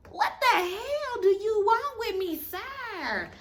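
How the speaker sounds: hammed-up, slow outraged demand; overdramatic confrontation. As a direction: do the absolute most, loud and high. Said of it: "doing the absolute most".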